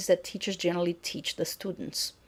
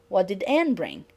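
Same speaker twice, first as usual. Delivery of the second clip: "Anne" stressed, pitch falling after it. Shown as a demonstration. Teacher repeats the question with focus on Anne. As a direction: lean on "Anne". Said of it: "Here the focus is on the agent".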